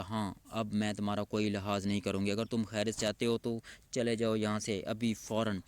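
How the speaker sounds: usual speaking voice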